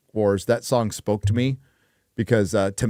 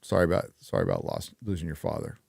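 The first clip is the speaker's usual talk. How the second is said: sincere condolence; 7 semitones lower, 8 dB quieter, faster than usual. Real, not acted. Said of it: "sincere condolence".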